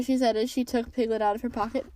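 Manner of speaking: usual speaking voice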